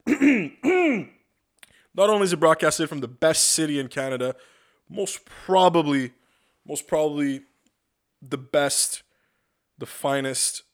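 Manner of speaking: slow, loud, booming host announcement; mock-grand broadcast intro; grand announcer voice. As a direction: puff it up like a proud radio host. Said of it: "pompous broadcast announcement".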